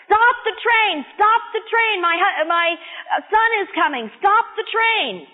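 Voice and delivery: high, urgent mock yell; acted screaming. Mother to train dispatcher as train leaves, mock screaming. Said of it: "screaming at the train dispatcher".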